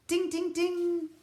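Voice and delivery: high, slow ringing ding ding ding; high sung coin dings. Acted. Narrator sounding coins hitting the counter, high ringing chimes.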